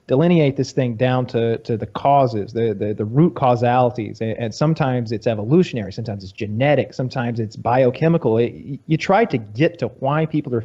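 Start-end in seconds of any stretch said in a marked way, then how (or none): none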